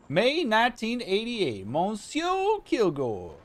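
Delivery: a bad, half-hearted French accent reading a letter; mock French accent, stretched name; attempted French accent. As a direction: try a French accent and fumble it. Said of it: "I can't do a French accent".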